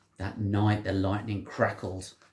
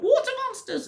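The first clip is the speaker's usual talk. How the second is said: very high, louder yelp of fright; frightened cry; 5 dB louder than usual. A narrator's reading. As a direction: cry out in panic. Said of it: "And one of them cried".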